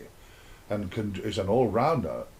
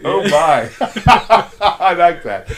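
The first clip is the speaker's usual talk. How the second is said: higher and much louder, laughing through it; a giddy God cracking himself up; laughing delighted God; 7 semitones higher, 13 dB louder, slower than usual. Retold or imitated. God admiring creation, laughing loud and high.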